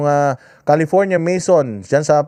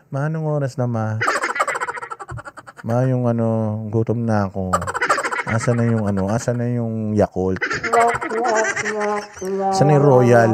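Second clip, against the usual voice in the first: drowsy, quiet whining to mom, pretending to have slept; fake sleepy kid; 4 semitones lower, 4 dB quieter than usual. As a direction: mumble it like a child faking he just woke up. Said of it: "para kunyari bagong gising ako".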